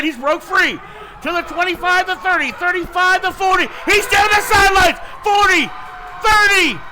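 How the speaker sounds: high, loud play-by-play shout building to a roar; touchdown call. Real, not acted. Touchdown run called live.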